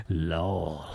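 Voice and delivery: low, deadpan read-out lol; mock chat reply. Reading a creep's chat aloud, in low mock voice. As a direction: drop low for the typed laugh.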